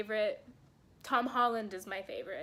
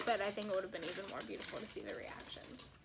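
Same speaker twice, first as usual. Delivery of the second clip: whisper; 3 semitones lower, 8 dB quieter, faster than usual. Real, not acted. Whispered aside about reactions.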